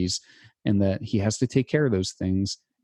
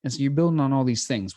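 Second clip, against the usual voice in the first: usual speaking voice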